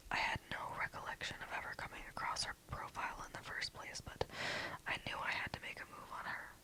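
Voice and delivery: ordinary delivery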